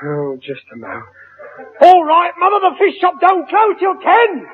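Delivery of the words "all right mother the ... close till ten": calling out. Acted. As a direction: shout across the house to his wife. Calling out to his wife offstage.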